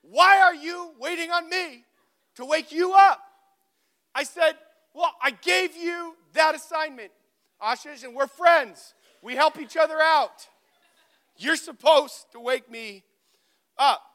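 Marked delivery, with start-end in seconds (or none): 0.0-3.2 loud, high-pitched acted scolding; indignant rebuke. Friend refusing blame for the wake-up, loudly indignant. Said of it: "he had the audacity to say". 5.0-14.0 loud, high, emphatic mock outrage; indignant argument. Student reminding friend of his assignment, loud indignant protest. Acted quarrel, retorting to friend.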